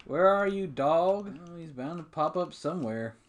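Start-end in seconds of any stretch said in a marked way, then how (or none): 0.1-1.4 raised, higher voice calling a lost dog. Real, not acted. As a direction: shout across the farm for the dog.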